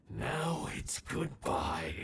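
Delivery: cold farewell. Acted. Villain bidding the group farewell before the blast, cold slow goodbye. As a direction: let each word drop like a verdict.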